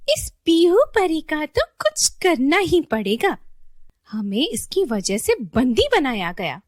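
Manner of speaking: loud, high scheming fairy; vengeful villain fairy. Acted. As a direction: play a wicked fairy fuming about revenge. Villain fairy plots revenge.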